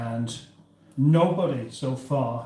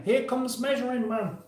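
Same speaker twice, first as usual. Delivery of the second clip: high announcer call, pitched way up; announcer intro; 10 semitones higher than usual. Acted. Announcing the guest to stream viewers, high announcer voice. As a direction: announce him like a game show host. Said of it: "Now then".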